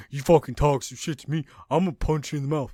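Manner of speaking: mocking impression of someone acting tough; mock tough talker. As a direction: put on a would-be brawler's threat. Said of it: "You do not sound tough".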